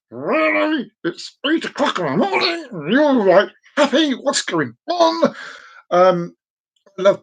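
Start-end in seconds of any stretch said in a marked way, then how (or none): 0.1-5.3 high-pitched disbelieving boss voice; incredulous boss. Acted. Boss faced with cheery morning greeting, incredulous. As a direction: squeal it in mock disbelief. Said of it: "seeing their face going, "Really?".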